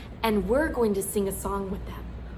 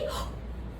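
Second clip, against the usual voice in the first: surprised gasp; 12 dB quieter than usual. Shown as a demonstration. Gasp at the reveal.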